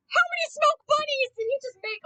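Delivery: real delighted disbelief; amazed. Real, not acted. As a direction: gasp at the smoke rings. Watching character smoke.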